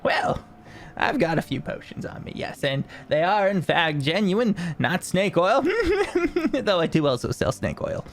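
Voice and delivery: high merchant voice with a chuckle; cheeky salesman admitting a trick; jolly tinkerer. Acted. Tinkerer reassuring buyers about potions, high and cheeky.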